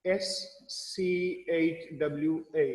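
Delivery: slow letter-by-letter spelling of schwa; spelling out slowly. Shown as a demonstration. Teacher spelling schwa for students, slowly. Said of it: "इसका नाम है शुआ".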